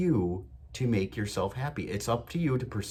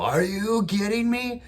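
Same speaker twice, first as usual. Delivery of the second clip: much higher, louder, slow goofy amazement; goofy loud excitement; 12 semitones higher, 4 dB louder, slower than usual. Retold or imitated. Dad hyping up his moody daughter in the car, loud goofy disbelief. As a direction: play it up like a silly excited dad. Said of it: "real goofy".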